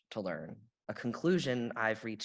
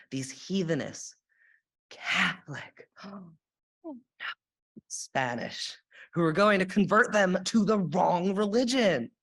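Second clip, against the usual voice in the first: mock horrified, gasping, then louder; scandalized colonist; 6 semitones higher, 5 dB louder than usual. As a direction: act out a shocked puritan.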